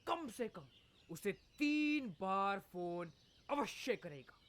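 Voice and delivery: mock newsreader delivery; news read.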